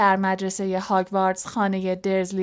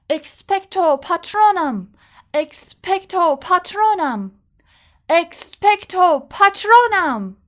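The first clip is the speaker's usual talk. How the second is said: higher, louder shout, slow and building; roaring spell; 9 semitones higher, 7 dB louder than usual. Acted. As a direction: roar the spell harder each time. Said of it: "هری نعره زد".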